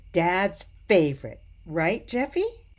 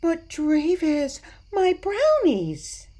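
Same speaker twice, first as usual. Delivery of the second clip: high, slow pleading whine; 11 semitones higher, slower than usual. Acted. Grandma pleads with Dravis not to leave before dessert, high and slow. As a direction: stretch it out, begging him to stay.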